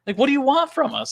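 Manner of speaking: exasperated retort. Retold or imitated. Owner answering a reviewer who wanted smiles, exasperated. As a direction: plead with them to be fair.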